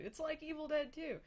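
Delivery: high, loud mock sales pitch; mock hype. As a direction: oversell it like an eager filmmaker. Hyped pitch, then whispered denial.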